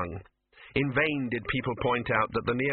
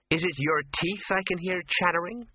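put-on higher young woman's voice; 7 semitones higher than usual. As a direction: voice Ginger, light and higher. Ginger voiced by narrator.

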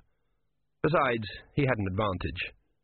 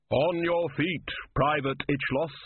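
slow, higher-pitched order; commanding summons; 5 semitones higher, slower than usual. Acted. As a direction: order the private to his feet, drawn out. Figure orders dead soldier up.

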